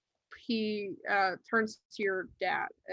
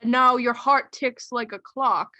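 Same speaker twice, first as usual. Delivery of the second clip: higher, louder pronouncement; alarmed doctor; 4 semitones higher, 9 dB louder than usual. Acted. Doctor to boy about his ticking heart, alarmed. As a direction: announce the troubling finding emphatically. Game master playing the doctor.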